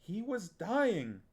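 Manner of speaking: high, slow pleading protest; a boy pleading his case to his aunt. Acted. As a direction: defend yourself desperately. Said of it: "Geryon protested".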